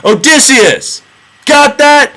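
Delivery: high, loud mocking shout; Odysseus taunting the blinded cyclops from the boat; yelling taunt. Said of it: "Odysseus turns around and yells".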